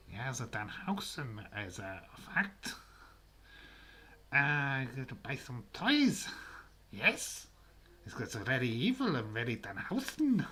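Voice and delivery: an odd, put-on, theatrical character voice; Danhausen impression. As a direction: play the spooky wrestler's voice, hamming it up. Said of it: "that's my Danhausen impersonation".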